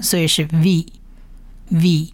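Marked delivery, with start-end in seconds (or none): 1.7-2.1 "v" said as vi, with short i; blended short i. Shown as a demonstration. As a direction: repeat "v" as the syllable vee, spaced out. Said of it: "短一的音，所以是". (拼读示范“v”，读作vi)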